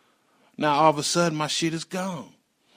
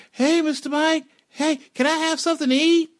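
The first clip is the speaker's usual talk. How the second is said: put-on much higher, small begging child; kid voice; 4 dB louder than usual. Boy Sonny begging neighbor Mike for food, high childlike. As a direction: squeak it out like a hungry kid. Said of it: "So Sonny come over".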